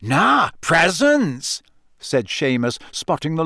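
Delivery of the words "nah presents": drawn-out, swooping higher exclamation; delighted discovery. A narrator's reading. Seamus spotting the pile at his bed, delighted discovery. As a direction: stretch both words with glee.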